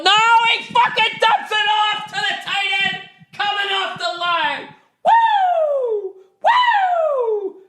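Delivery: very high-pitched cheering shout; real whooping fan excitement; excited sports yell. Real, not acted. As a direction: yell the touchdown play as it happens. Reacting live to the football game.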